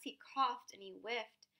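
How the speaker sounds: ordinary delivery, nothing marked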